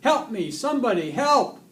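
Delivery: high, loud cry for help; panicked scream. A narrator's reading. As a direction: scream for somebody to rescue you. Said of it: "threw his hands up in the air and screamed".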